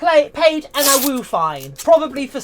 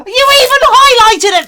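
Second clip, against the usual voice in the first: excited squeal; 12 semitones higher, 10 dB louder than usual. Real, not acted. Excited streamer reacting to chat.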